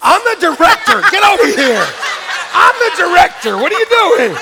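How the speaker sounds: high, loud bossy outburst; mock exasperation. Director calling a stray actor back into place, mock-exasperated shout. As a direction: shout it like a put-upon director.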